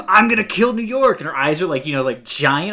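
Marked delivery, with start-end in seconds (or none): 0.1-1.2 loud, high hammed-up villain line; mock villain shout. Mocking the villain's overacted threat, loud and high. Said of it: "And she's like".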